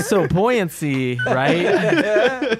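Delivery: high laughing through the words; cracking up mid-sentence. Real, not acted. Cracking up at pool logic.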